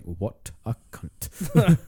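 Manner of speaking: very slow, stretched-out mock verdict; drawn-out retold judgement. Friend recalls his thought on walking away, drawn-out mock contempt. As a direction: drag out each word as a retold private thought.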